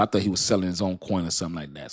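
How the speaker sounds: normal talking voice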